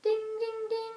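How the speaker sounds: high sung bell ding; mock alarm ding. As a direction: ring it like an alarm.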